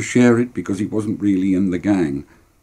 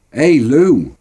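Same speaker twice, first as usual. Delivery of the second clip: loud, high hail to a mate; calling out; 6 semitones higher, 6 dB louder than usual. Acted. Boy hailing Lewis at his house, calling out. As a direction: call across to him.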